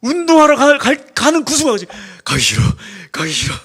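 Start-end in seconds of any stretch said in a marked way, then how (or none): 2.2-3.5 whining. Retold or imitated. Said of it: "이러고 제가 가요".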